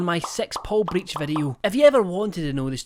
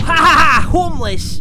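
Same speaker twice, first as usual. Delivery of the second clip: loud jeering laugh and shout; mocking laugh shout; 9 semitones higher, 14 dB louder than usual. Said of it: "sit here and go".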